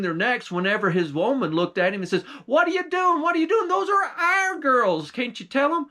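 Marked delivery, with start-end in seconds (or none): 2.4-5.9 shrill giantess. A narrator's reading. Giantess scolding her husband for grabbing their own girls, high screech. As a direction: shriek at him to stop.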